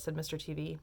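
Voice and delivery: ordinary delivery, nothing marked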